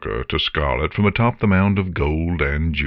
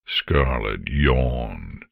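plain reading voice

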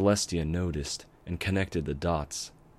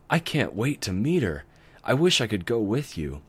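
put-on higher, louder kid voice, longing; eager young dragon; 5 semitones higher, 5 dB louder than usual. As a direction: wish hard like a little kid. Spike eager to meet her.

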